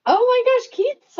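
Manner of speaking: sudden high, loud yelp of surprise; real surprise at a familiar name; surprised. Real, not acted. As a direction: caught off guard by a viewer turning up in the chat. Spots Keith in live chat.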